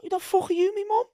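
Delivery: high-pitched imitation of an indignant telling-off; hammed-up, high indignant outburst; mock outrage. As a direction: mimic a teammate's outraged retort. Retelling teammate's indignant outburst.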